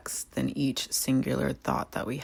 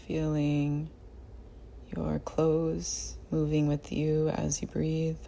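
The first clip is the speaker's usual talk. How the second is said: guided meditation; 6 dB quieter than usual. Real, not acted. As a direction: guide them gently through the body, unhurried. Leads a body scan meditation.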